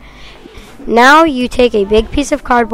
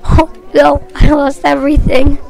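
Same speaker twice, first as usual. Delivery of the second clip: high, loud frantic cries of no; mock earthquake panic; 5 semitones higher, 4 dB louder than usual. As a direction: wail over the broken TV. Another acted earthquake, high loud.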